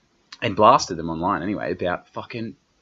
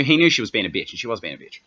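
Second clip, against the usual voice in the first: ordinary delivery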